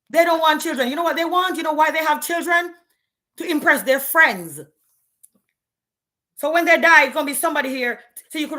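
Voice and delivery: ranting loud and high about men; heated rant. Real, not acted. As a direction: climb up and push it out.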